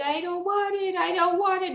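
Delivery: high, whiny "I don't want it" in a lightly acted cry; crying child's plea. Retelling her panic at the finger prick, high imitated crying plea. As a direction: whimper it like a scared thirteen-year-old. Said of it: "I'm, like, crying".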